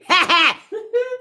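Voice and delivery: a quick high burst of laughter. Real, not acted. After teasing the men, a high amused laugh. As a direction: laugh at your own joke.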